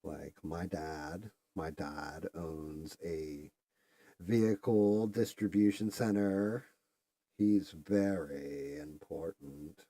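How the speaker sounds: slow, drawn-out mock Valley Girl; ditzy Valley Girl. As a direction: brag about daddy like a spoiled valley girl. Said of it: "Valley Girl day, like, girls acting ditzy".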